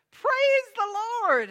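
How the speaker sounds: very high, loud, drawn-out holler; exultant full-voiced cry; jubilant shout. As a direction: belt out praise, stretching every word. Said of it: "and shouted".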